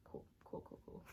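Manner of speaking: unvoiced murmur of cool, cool; soft whispered repetition. Real, not acted. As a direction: whisper it to yourself.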